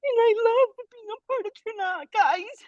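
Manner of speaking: fighting back happy tears; overwhelmed and near tears; tearfully emotional. Real, not acted. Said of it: "I'm so emotional".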